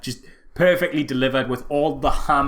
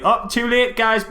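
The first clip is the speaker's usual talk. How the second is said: mock taunt; 8 semitones higher than usual. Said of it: "And then, like".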